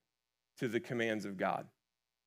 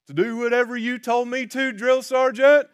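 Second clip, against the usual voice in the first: higher, louder earnest Forrest impression; Forrest Gump voice; 13 semitones higher, 13 dB louder than usual. Forrest answering the drill sergeant, loud and earnest. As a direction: shout back like an eager simple recruit.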